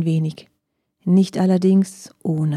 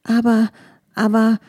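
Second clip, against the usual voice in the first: higher, louder halting stammer; 4 semitones higher, 4 dB louder than usual. A narrator's reading. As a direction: stumble over the words, flustered. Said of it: "stammelte nun die Füchsin". (stammelnd, höher und lauter)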